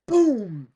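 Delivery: loud, high shouted boom; booming impact. Retold or imitated. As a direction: shout the hit as one big blast. Imitates thrown snowball hitting.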